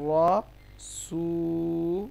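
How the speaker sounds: রসু very slow, rounded "raw" then a long-held "soo"; heavy Ra, stretched. Shown as a demonstration. Teacher spells out the example, রসু stretched with heavy Ra. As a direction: hold both sounds of রসু, with a thick R. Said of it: "র মোটা হয়".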